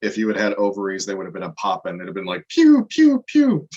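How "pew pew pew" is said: high, loud "pew" sound effects; imitated shooting noise. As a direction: fire off squealed pews like a toy gun.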